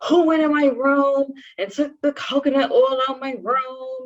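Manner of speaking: high, sharp imitation of an angry mother; mom's angry demand. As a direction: mimic mom confronting her daughter. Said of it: "And she's like".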